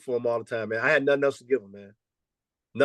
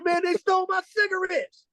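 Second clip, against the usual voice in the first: much higher put-on young player, aggrieved; imitating a young player, much higher; player's voice. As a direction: squeak it out like a wronged kid. Coach voicing his player.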